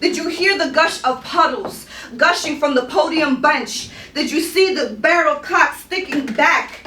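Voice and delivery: rhythmic, insistent poem recitation; spoken-word poetry. Acted. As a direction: perform it like live spoken word, pitched above chat.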